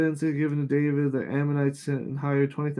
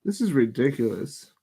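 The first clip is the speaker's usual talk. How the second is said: normal talking voice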